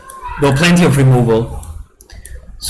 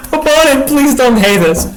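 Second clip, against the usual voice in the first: higher-pitched, amused pleading; laughing plea; 8 semitones higher than usual. Real, not acted. Player begging the opponent not to hate them, amused. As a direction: plead while holding back real laughter. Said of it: "I am so sorry I'm laughing".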